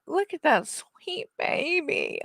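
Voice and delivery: drawn-out doting coo. Real, not acted. Cooing over the dog again.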